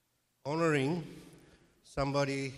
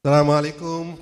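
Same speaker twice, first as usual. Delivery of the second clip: very loud greeting into the microphone; too loud; 13 dB louder, much faster than usual. Real, not acted. Speaker greets the interfaith dinner audience, far too loud. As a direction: boom out the greeting, much louder than usual. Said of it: "Sorry, I'm too loud".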